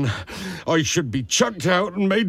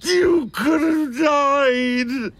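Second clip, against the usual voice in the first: crying out; 8 semitones higher, much slower than usual. Acted. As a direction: cry it out in despair. Hagrid breaking down crying.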